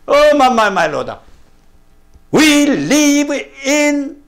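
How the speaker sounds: normal talking voice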